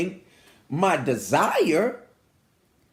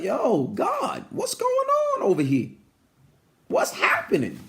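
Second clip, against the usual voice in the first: higher-pitched, incredulous everyday questioning; casual questioning of God; 12 semitones higher than usual. Acted. Preacher talking to God like a person, casual high-pitched questioning. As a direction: challenge God like a friend who owes answers. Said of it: "like you would normally talk to a person".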